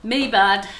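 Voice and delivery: loud, playful "me bad". Real, not acted. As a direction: own the mistake cheekily. Jokingly owning up to a mistake.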